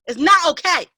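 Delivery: It's not okay, yelled at full pitch; rising yell. Acted. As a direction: shout it the second time.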